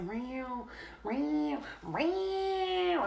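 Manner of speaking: high, drawn-out cat meows; rising feline yowls; imitated cat meow. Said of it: "He's like".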